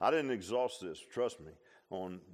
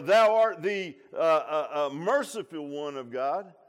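mock wrong quote; 3 semitones higher, 11 dB louder than usual.

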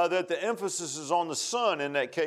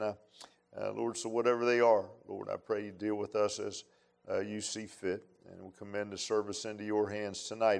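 prayer; 6 semitones lower than usual. Acted. Pastor prays over the congregation before the sermon, hushed prayer.

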